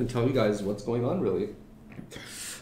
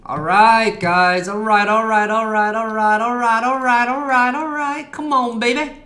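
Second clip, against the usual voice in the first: excited cheering; 10 semitones higher, 9 dB louder, slower than usual. Real, not acted. Excited cheering at crashing chart.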